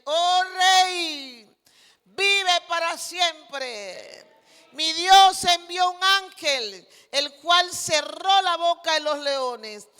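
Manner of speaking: loud, ringing declaration to a king; triumphant proclamation. Acted. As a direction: proclaim it loudly, drawing out the opening words. Daniel's triumphant answer to the king. (proclamación triunfante a viva voz)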